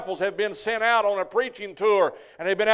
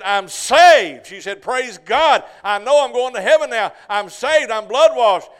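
loud, high, exultant cry; joyful shout; 3 semitones higher, 10 dB louder, slower than usual. Retold or imitated. Newly saved woman to the preacher, loud joyful shouting. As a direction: burst out with it like a new convert. New convert shouting joy.

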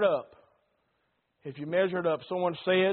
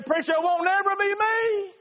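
much higher, mock sure of himself; confident listener; 11 semitones higher than usual. Churchgoer boasting to the preacher, mock confident. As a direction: brush it off boldly. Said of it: "You say".

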